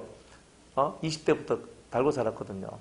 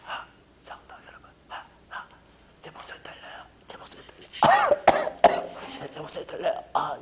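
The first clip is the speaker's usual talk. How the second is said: voiceless whisper of a ruined throat; lost voice. Retold or imitated. Pastor imitating himself with a ruined voice, whispering. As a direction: rasp it out as if the voice is gone. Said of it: "목이 어떻게 되냐. 이럴 때".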